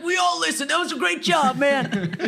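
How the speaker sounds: high, laughing buddy voice; imitating neighbor. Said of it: "It's like".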